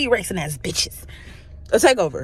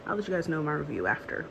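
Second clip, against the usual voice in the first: usual speaking voice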